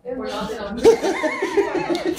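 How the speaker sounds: high burst of laughter. Real, not acted. Laughs at stuffed mouth.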